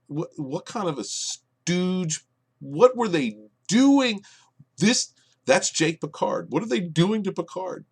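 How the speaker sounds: high, slowed, disbelieving cries; can't believe what they did to Picard; incredulous outburst. Real, not acted.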